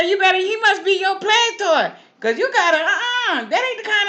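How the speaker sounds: high, loud call of caution; loud warning. Pretend. Old lady hollers warning to Miss Anna Mae, high and loud. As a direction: shout a warning down the street. Calling warning to neighbor woman.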